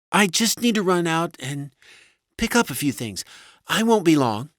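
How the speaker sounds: high-pitched wife making excuses; high, hesitant wife voice; evasive wife. A narrator's reading. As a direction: make a halting excuse in a high voice.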